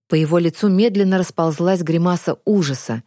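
plain reading voice

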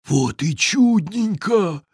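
slow, high-pitched gloating troll; delighted troll whisper. A narrator's reading. Troll finds the treats unguarded, delighted stage whisper. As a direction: stretch it out in secret delight. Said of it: "прошептал он".

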